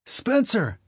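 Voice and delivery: put-on high, loud happy boy call; excited kid. Boy greets brother.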